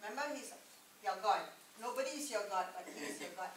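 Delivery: normal talking voice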